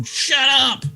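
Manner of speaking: high-pitched yell. Acted. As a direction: yell it mid-attack. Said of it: "at the same time as yelling".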